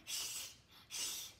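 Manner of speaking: soft breathy hiss; goose hiss. Shown as a demonstration.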